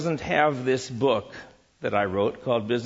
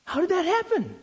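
much higher, wide-eyed question; amazed wonder. Real, not acted. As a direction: ask it as if truly astonished. Marvelling at mutual benefit.

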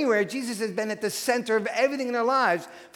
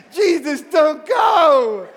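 high, loud, drawn-out plea; mock desperate shout; 8 semitones higher, 10 dB louder, much slower than usual. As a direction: cry out like a child begging him to stay. Disciples cry out like the children.

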